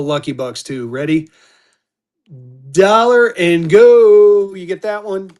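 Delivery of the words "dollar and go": loud, slow drawn-out call; auction call. Real, not acted. Host kicks off the stack for viewers, drawn-out loud call. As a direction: stretch it out like an auctioneer starting the bidding.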